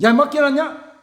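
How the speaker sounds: loud, high scolding bark; irritated parent retort; annoyed father. Retold or imitated. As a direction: snap at the kid like a fed-up dad. Father scolding child asking money.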